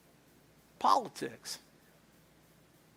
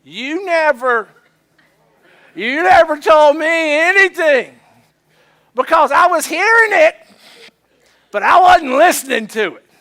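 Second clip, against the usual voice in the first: defensive shout; 7 semitones higher, 12 dB louder than usual. Retold or imitated. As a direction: hurl the never back at her. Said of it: "here's what we say".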